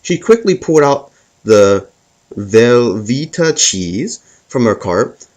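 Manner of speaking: ordinary talk, nothing marked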